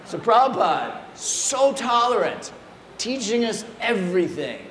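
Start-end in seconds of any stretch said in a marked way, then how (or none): none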